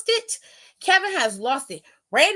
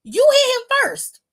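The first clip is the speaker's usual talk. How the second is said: high, loud incredulous questioning; asking in stunned disbelief at the wrestler; shocked disbelief; 9 semitones higher, 4 dB louder than usual. Retold or imitated. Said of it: "I was shocked because I'm like".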